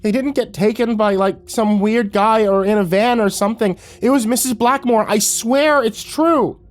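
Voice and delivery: put-on a child pushed to tears insisting; desperate boy. A narrator's reading. Boy insists to his mom, high and breaking. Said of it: "his voice broke on the last syllable".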